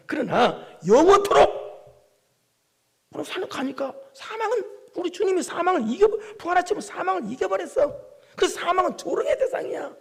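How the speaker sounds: triumphant preaching. Acted. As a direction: proclaim death is beaten.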